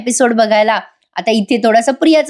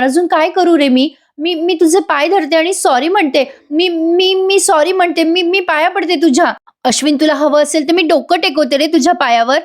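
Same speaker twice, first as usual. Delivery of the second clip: begging, voice climbing much higher; desperate begging; 6 semitones higher than usual. Retold or imitated. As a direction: beg at his feet, pitch rising high. Wife begging, pitch climbing high. (वर चढत जाणाऱ्या आवाजात गयावया करणे)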